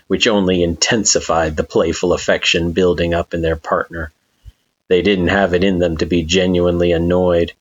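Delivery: ordinary delivery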